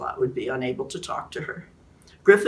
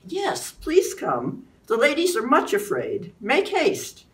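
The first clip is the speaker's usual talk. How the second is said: much higher, very slow helpless lady plea; womanly pleading; 9 semitones higher, 4 dB louder, slower than usual. A narrator's reading. Guinevere luring Griffith outside, high slow pleading. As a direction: play the frightened lady to lure him. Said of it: "summoning her most womanly tones".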